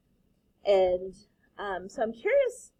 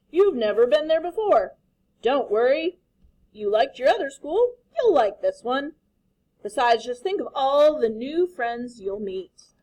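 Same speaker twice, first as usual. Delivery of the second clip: reassuring dad; 4 semitones higher, 8 dB louder than usual. Acted. Father reassuring.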